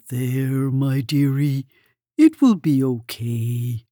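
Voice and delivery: calm her down tenderly; soothing Mole. A narrator's reading. Mole comforts Hedgehog.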